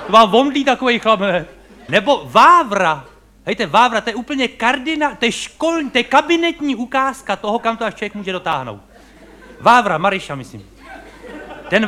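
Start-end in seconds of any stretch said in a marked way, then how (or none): none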